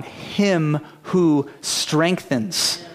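"him who strengthens" drawn out slowly, word by word; weight "him", then pause before "strengthens"; "him who strengthens" spaced out with deliberate pauses; stress on HIM WHO STRENGTHENS. Shown as a demonstration. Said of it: "The emphasis of the verse is on".

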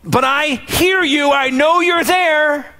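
high, slow, pleading cry; screaming out. Acted. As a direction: call out to him from far off, high and drawn out. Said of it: "screams out".

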